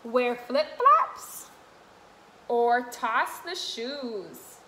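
sing-song picture book read; reading to children. Acted. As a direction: read the rhyme brightly to little ones. Continues reading rhyming book.

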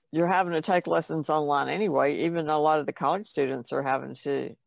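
ordinary talk, nothing marked

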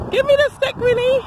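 very high demand to a dog; high-pitched doggy talk; playful pet command. Real, not acted. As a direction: coax the dog in a high squeaky voice. Playing fetch with her dog.

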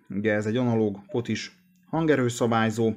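ordinary talk, nothing marked

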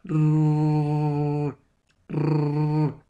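long rrr with the tongue curled up to the palate; English r sound. Shown as a demonstration. Teacher demonstrating the English r to students. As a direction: hold a retroflex English rrr. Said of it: "cogemos la lengua ... arriba del paladar".

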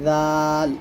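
long light "জাল", tongue tip on upper teeth edge; thin. Shown as a demonstration. Teacher contrasting the lighter letter, thin "জাল". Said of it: "জাল পাতলা হবে".

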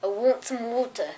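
soft, lightly put-on British accent; understated mock-British accent. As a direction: try a British accent, quietly.

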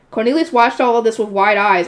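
ordinary delivery

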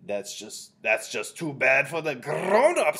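loud, drawn-out witch voice with rolled R; dismissive Grand High Witch. A narrator's reading. Grand High Witch brushing off a question, loud dismissal. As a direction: stretch it out loudly and roll the R.

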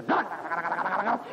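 rapid rolling thunder noise; quick mock thunder rumble. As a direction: rattle it out fast like thunder. Imitating thunder.

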